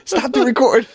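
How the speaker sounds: laughing through the words; high laughing plea. Real, not acted. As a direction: giggle out a stop.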